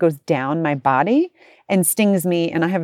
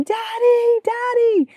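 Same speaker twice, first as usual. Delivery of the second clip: mock child scream; much slower than usual. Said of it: "she starts screaming".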